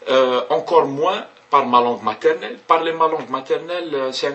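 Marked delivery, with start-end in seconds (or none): none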